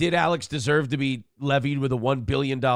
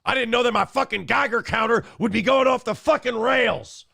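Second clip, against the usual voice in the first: mock Alex; 7 semitones higher than usual. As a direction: ham up his alarm. Continuing the Alex impression.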